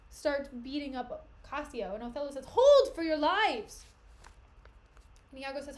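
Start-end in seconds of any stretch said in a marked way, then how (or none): 2.5-3.8 slow, loud "Hold for your lives"; loud slow command; commanding shout. Acted. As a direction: bellow to stop the fight. Othello stops the brawl.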